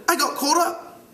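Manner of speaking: high British schoolboy voice; British accent. Acted. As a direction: play a piping British boy. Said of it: "try to do it with a British accent".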